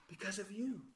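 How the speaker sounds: very soft, solemn reply; quiet, weighty confession; hushed answer. Real, not acted. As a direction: drop to a hush and let each answer land. Solemn answer to own question.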